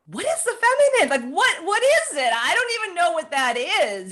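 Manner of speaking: very high, loud bewildered questioning; baffled question. Retold or imitated. Retelling her confusion about the feminine, very high bewildered voice. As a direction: ask it completely puzzled.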